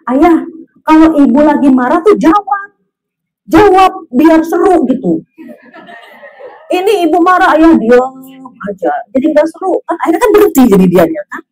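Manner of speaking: high, loud nagging wife; hammed-up scolding, demanding an answer; mock angry wife. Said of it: "Ketika istrinya marah-marah".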